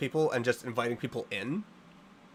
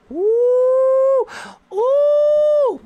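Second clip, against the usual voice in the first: mock wail; 11 dB louder than usual. Mock threat to donor.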